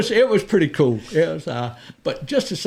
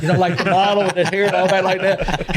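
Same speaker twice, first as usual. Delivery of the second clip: normal talking voice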